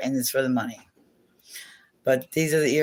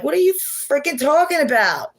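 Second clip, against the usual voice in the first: much higher, put-on indignant voice; mock-indignant self; 12 semitones higher, 4 dB louder than usual. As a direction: act out your own retort, hammed-up. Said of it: "I'm like".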